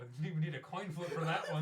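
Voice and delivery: laughing. Real, not acted. Player cracking up at the doors.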